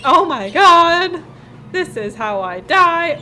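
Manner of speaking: loud, slow, hammed-up panic; freaking out. Player retelling a scare from a passing truck, mock panic. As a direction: re-enact a panicked wail, stretching the words. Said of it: "like, really freaking out".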